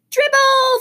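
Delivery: put-on high, loud toddler holler; gleeful shout from a small child. A narrator's reading. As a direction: bellow the pet's name like an excited little boy. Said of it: "Fudge hollered".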